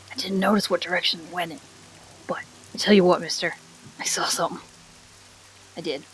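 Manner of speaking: put-on hushed conspiratorial kid voice; wholesome 1950s kid. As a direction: lean in and share a secret. Kaleran NPC confides.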